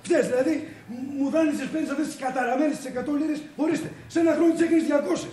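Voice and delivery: high, loud tirade; angry accusation. Acted. Debtor accusing his friend of doubling the loan, angry.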